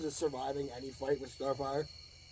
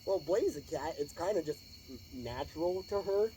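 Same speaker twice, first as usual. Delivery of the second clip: usual speaking voice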